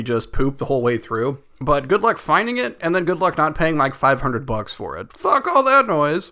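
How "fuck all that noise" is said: exasperated. Real, not acted. Exasperated dismissal of pricing.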